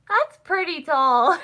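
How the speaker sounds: high, loud, drawn-out amazement; mock awe. Student reacting to a very tall classmate, loud drawn-out awe.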